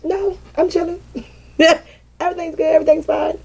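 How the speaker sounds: high, breezy mock denial; fake innocent. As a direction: play it sweet and unbothered. Mock innocent reply to people.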